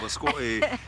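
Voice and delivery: "Biscotti" with an amateur Italian accent, questioning; attempted Italian flair. Shown as a demonstration. Husband testing the word on wife, attempted Italian flair. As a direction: try "Biscotti" in Italian, unsure of it. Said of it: "say it with an Italian flair".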